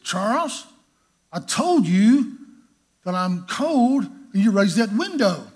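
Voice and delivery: mock outrage at the open window; angry complaint. [name] scolding Charles for raising the window, loud and slow.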